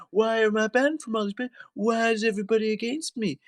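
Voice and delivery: high, whiny mock complaint; mock self-pity. Mimicking her complaints about bans, mock self-pitying.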